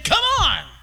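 loud, high come on; shouted urging. Acted. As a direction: call out like pulling them along. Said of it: "God is saying".

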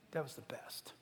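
unvoiced whisper, breathy aside. Preacher voicing Jesus, confiding, in a whisper. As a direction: breathe it like a secret.

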